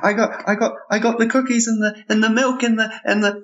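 put-on high, babbling child's voice; excited kid. Boy offering Santa cookies and milk, high and flustered. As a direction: stumble eagerly through it like a small boy.

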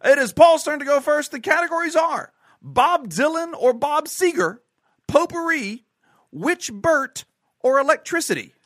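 game show host. Pretend. Host giving Paul the quiz categories, announcer delivery. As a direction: present the categories with showy gusto.

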